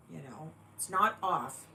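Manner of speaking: ordinary talk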